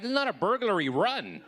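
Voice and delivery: high, panicked outburst; a sudden squeal of alarm; shrieking at the girlfriend to go away; frantic yelp. Retold or imitated. Frantic reply before pooping.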